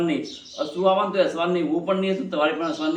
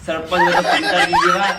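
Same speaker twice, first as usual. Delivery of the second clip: loud cheer broken up by laughter; laughing mock praise; 2 semitones higher, 7 dB louder, slower than usual. Real, not acted. Mocking the sarpanch's water promise, laughing loudly. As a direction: hoot it out through real laughs.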